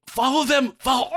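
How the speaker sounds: high hammed-up shout of command; villain shouting. Smythe urging the man wolf on, high mock shout. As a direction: yell it like a desperate comic villain. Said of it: "he's like".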